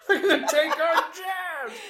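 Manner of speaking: high, shrill-edged mocking panic voice. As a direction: ham up the panicked townsfolk.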